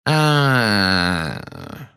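long, falling, knowing "aaah"; drawn-out "aah". A narrator's reading. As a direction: stretch out a mocking sound of realisation. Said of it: "снова протянул Фадж".

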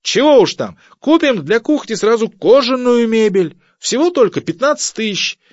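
very high, loud sarcastic screech; squealing. Acted. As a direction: screech mock suggestions at the wife. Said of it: "завизжал Семён Семёнович". (истошный язвительный визг)

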